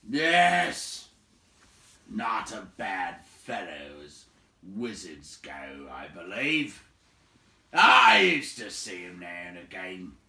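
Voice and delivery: loud, slow, booming woodsman; gruff giant. A narrator's reading. Big man recalling Radagast, loud gruff boom. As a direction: boom it out grudgingly. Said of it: "said Beron".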